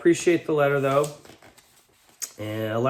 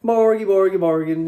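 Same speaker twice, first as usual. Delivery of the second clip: high, loud mock Swedish Chef babble; sing-song gibberish chef voice, much higher and louder; Swedish Chef impression; 8 semitones higher, 8 dB louder than usual. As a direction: ham it up like the Muppets' Swedish cook. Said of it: "the Swedish cook from the Muppets".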